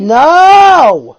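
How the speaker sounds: high, loud drawn-out no; exasperated shout. Real, not acted. Host rejecting co-host's "scaly" joke, exasperated shout. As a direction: cut the teasing off with a yell.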